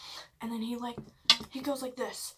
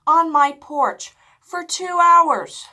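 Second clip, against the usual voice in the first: much louder and higher, drawn out; loud indignation; 7 semitones higher, 15 dB louder, slower than usual. Real, not acted. Telling how he called his ex, loud indignation. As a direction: hammer it out slowly in outrage. Outraged at ex-girlfriend call on porch.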